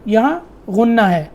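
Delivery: ordinary talk, nothing marked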